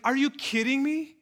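high, startled question; asked in happy disbelief; amazed disbelief. Retold or imitated. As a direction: gasp it out in disbelief. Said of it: "I'm like".

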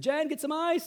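calling out. Retold or imitated. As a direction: re-enact hollering to a wife indoors. Calling to wife for ice.

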